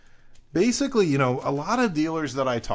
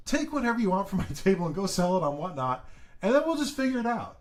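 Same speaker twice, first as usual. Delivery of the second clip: laughing quoted dealer; 6 semitones higher than usual. Retold or imitated. Quoting a friendly dealer.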